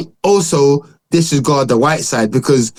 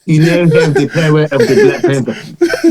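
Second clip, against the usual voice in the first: slow mock African film accent, bent vowels; slow, mangled African accent impression amid laughter; Forrest Whitaker. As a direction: stretch out a bad African movie accent. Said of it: "he's like this".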